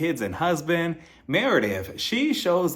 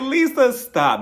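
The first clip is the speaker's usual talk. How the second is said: exasperated mock scolding; 9 semitones higher, 6 dB louder, slower than usual. Retells her own scolding of Lisa.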